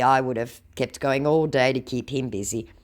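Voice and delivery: ordinary delivery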